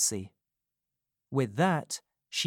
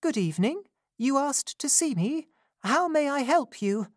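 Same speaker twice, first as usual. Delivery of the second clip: put-on kind, gentle young lady; 14 semitones higher than usual. A narrator's reading. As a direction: greet her gently, like a caring young lady. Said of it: "in such a kind and gentle voice".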